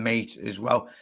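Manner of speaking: ordinary delivery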